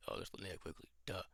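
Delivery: ordinary talk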